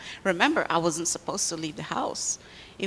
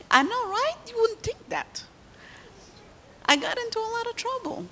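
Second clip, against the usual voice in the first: playful surprise. Real, not acted. Playful confession to the children.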